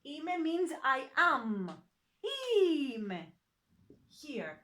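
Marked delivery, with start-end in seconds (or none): 2.2-3.3 Ime with a long, stressed opening "I"; stress on opening sound. Shown as a demonstration. Teacher showing stress on a double vowel, Ime stressed on a drawn-out opening. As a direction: stretch and push the start of Ime. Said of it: "in the second".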